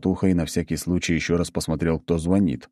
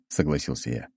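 ordinary delivery, nothing marked

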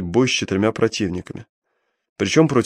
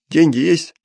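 gruff thug; 6 semitones higher, 3 dB louder than usual. Acted. Thug demands money.

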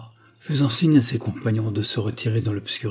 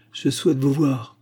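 plain reading voice

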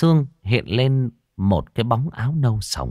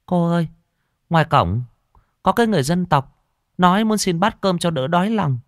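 lightly voiced servant, higher pitch; a slightly raised, reporting servant voice; 8 semitones higher than usual. A narrator's reading. Cook telling her mistress of a beggar, lightly raised voice.

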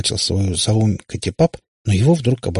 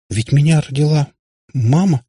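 higher, halting little protest; bewildered babble; 7 semitones higher, 4 dB louder than usual. A narrator's reading. Katya insists she was born normally, babbling helplessly. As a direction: falter as if pleading. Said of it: "продолжала лепетать Катя".

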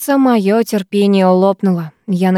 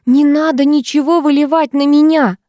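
high-pitched, moderately raised outcry; yelling in protest; 6 semitones higher, 3 dB louder than usual. A narrator's reading. Girl begging not to be soaked, yelling. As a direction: wail it as a panicked protest. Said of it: "вопила я".

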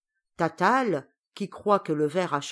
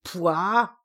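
louder yuck of disgust; disgusted exclamation; 5 dB louder than usual. Acted. Witch recoiling at dirty nails, louder disgust. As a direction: blurt out your disgust. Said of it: "s'exclama Tatale".

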